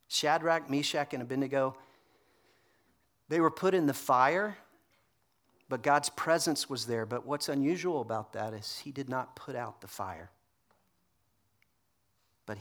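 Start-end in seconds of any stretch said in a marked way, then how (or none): none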